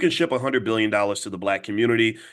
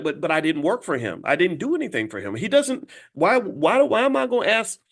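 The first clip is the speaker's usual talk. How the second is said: rising higher and higher in mock disbelief; indignant protest; 8 semitones higher than usual. Worker arguing with boss over unpaid wages, climbing indignation. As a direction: worker pleading, pitch climbing with outrage. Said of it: "You'd be like".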